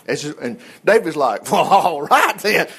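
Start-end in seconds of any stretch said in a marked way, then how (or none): 1.5-2.7 drawn-out, gung-ho acceptance; slow, relishing mock bravado; fired up. David hears of the reward, raring to go. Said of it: "he was ready to go".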